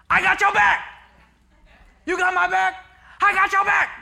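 very loud, high-pitched chant shout; team yell. Retold or imitated. Teammates answering their quarterback's chant, high team yell. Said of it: "And they yelled".

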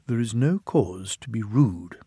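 usual speaking voice

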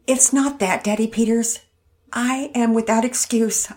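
wife ashamed, still upset; tearful. Acted. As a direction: confess it, still shaken. Tearful wife confessing shame.

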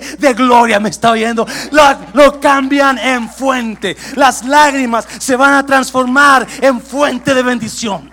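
shouted, high, fervent sermon cry; full-force preaching. Acted. Pastor shouting blessing over the congregation, full-force preaching. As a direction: preach it out to the whole church.

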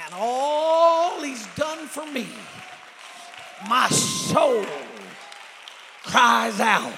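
drawn-out, full-voiced shout of praise; dramatic preaching. Preacher acting out his soul crying out, dramatic shout. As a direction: cry it out slowly with theatrical fervor. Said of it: "I feel a drama spirit coming on me".

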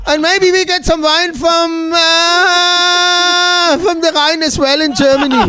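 loud, high-pitched mock German presenter, drawn-out filler; Dirk the German reviewer. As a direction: present wines as a hammed-up German critic. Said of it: "This is Dirk".

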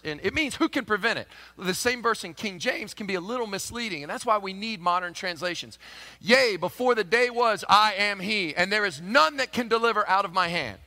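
ordinary talk, nothing marked

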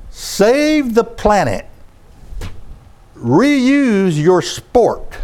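drawn-out, very slow sarcastic read; mocking sign read. Preacher scoffs at a billboard slogan, reading it slowly and mockingly. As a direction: read the billboard like it's ridiculous. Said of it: "It says this".

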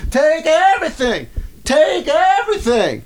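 imitated woman yelling. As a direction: shriek it like a frantic woman. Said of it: "then she's going".

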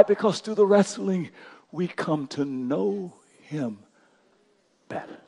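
drops to a near hush, slow. Real, not acted. Pastor closing the point, hushed and slow. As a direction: let it fall almost silent.

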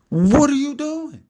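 high, drawn-out incredulous question; mock disbelief. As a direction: stretch it out in squeaky mock protest. Repeats mock protest to his son.

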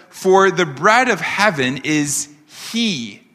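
slow reading, landing hard on "he"; stress on he. Shown as a demonstration. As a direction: draw out and set apart the final "he". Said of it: "you can underline that word".

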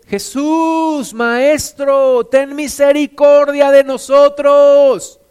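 high, loud desperate plea from afar; crying out loud. A narrator's reading. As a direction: call out to Jesus, begging, slow and loud. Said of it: "Y alzaron la voz diciendo".

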